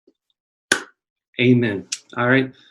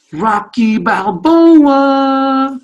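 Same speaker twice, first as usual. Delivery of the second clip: boxing announcer; 12 semitones higher, 8 dB louder, much slower than usual. Retold or imitated. Rocky introduction.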